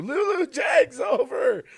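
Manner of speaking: high, loud, drawn-out call. Retold or imitated. Calls up Lulu that the dog is over, shouting. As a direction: holler the news, stretching the words.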